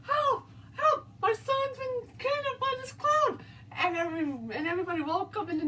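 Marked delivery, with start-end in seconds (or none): 0.0-3.4 frantic screams of a terrified father; screaming for help. A narrator's reading. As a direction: scream it out to the whole street.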